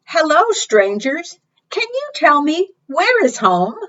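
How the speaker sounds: high, small voice of the lost chick; little bird voice. A narrator's reading. Storyteller voicing Pip calling out to strangers, high and small. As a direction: call out like a tiny bird. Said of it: "Pip called".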